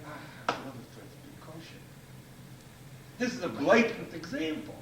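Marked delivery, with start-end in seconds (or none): 0.0-1.8 softer, quicker, a wistful sigh; private confession. Retold or imitated. Mother alone at night admits her wish, soft and wistful. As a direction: let the secret wish slip out. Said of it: "she said".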